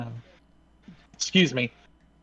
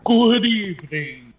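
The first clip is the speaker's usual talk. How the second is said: higher, theatrical vampire greeting; dramatic vampire voice; 6 semitones higher than usual. Acted. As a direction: chew the scenery like a vampire. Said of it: "Excellent! That's what I like to hear".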